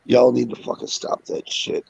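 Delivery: ordinary talk, nothing marked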